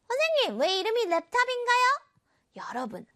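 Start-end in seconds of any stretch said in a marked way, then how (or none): 0.1-2.0 높은 목소리로 궁금한 학생처럼; 궁금해하는 아이처럼 물어봐. Pretend. (선생님에게 묻는 학생 목소리 흉내)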